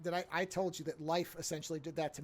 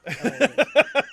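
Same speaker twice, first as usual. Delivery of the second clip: sudden recollection; 9 semitones higher, 16 dB louder than usual. Real, not acted. Remembering the angry book.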